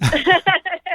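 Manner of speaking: a high, loud burst of laughter; bright laugh. Real, not acted. Guest laughing about her love for Kings of Leon, high burst of laughter. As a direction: laugh it off, delighted.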